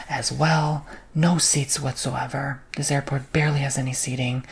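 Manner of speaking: normal talking voice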